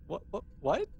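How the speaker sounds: ordinary talk, nothing marked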